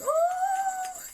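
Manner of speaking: high, loud gasped oh; thrilled at Santa's arrival; delighted high exclamation; excited surprise. Retold or imitated. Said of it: "And we'd all think".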